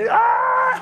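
high loud mock shout; hammed-up mother bear yell; mommy bear shriek. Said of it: "mommy bear and says".

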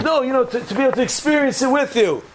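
high-pitched, placating protest; eager pleading. Acted. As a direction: plead your way into the invitation, voice up high. Acted writer pleads to come along.

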